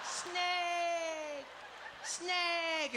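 very high, long-held taunt; hammed-up French-accented child's wail; mocking French kid. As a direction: jeer like a child, stretching the word. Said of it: "this kid's like".

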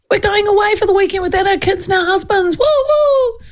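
high, quick, giddy group cheer; high-pitched party squeal ending in woos; excited girlfriends. Retold or imitated. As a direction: mimic giddy women whooping about their getaway. Voicing excited girlfriends on trip.

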